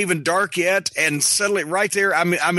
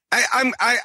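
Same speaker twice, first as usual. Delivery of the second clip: usual speaking voice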